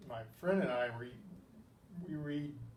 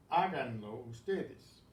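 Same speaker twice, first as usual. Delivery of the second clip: slow careful model; 5 dB louder, slower than usual. Shown as a demonstration. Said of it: "teacher models the command".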